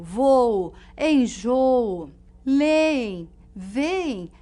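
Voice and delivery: "voo", "enjoo", "leem", "veem" with long drawn-out vowels; doubled vowel examples. Shown as a demonstration. Teacher listing doubled-vowel words, slow and drawn out. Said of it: "As palavras, por exemplo, como".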